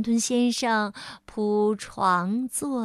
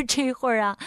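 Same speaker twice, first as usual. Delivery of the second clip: ordinary delivery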